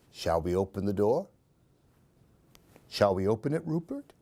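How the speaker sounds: usual speaking voice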